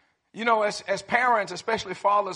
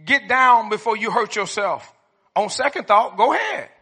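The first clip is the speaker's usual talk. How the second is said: dad voice; 2 semitones higher, 6 dB louder than usual. Retold or imitated. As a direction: warn like an exasperated father. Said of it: "I heard this one a lot".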